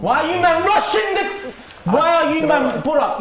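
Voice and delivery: shout. Real, not acted. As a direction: yell it, telling him off for rushing. Host scolds rushed answer.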